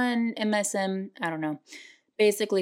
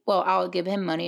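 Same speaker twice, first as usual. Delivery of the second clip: ordinary talk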